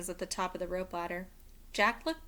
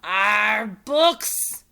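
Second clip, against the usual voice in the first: loud disgusted pirate growl; pirate captain growling at the word books; 3 semitones higher, 13 dB louder than usual. A narrator's reading. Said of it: "growled Captain Bones".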